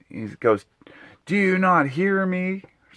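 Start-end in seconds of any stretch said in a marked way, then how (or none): 1.2-2.6 very high, slow imitating of an irritated neighbor; mock neighbor. Neighbor snapping across the woods, high slow imitation. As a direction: stretch it out high like a snippy neighbor.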